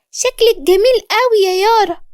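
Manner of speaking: put-on very high little-boy voice; high, delighted kid with a smile; smiling child. A narrator's reading. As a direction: pay a sweet compliment like a small child. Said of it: "دخل علي وقال بابتسامة".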